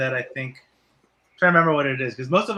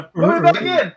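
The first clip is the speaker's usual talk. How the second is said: high, loud plea; tiny AirPod voice; 10 semitones higher, 7 dB louder than usual. Acted. Voicing AirPods.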